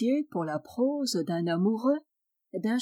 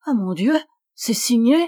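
ordinary talk, nothing marked

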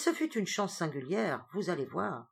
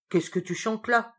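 furious and anxious; 4 semitones higher, 5 dB louder than usual. Acted. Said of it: "furieux et angoissé tout ensemble".

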